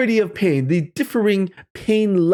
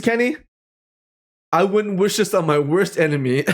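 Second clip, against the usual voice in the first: ordinary talk, nothing marked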